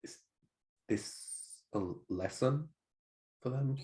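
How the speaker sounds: hushed, halting question; acted whisper. Hero speaking to another within earshot, acted whisper. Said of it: "I kind of, like, whisper".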